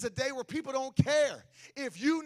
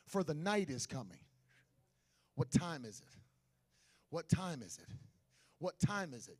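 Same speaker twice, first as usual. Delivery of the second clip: soft and low, intimate sermon; hushed preaching; 5 semitones lower, 4 dB quieter than usual. Acted. Preacher quietly warning the church that night is coming, soft. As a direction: draw them in close and quiet.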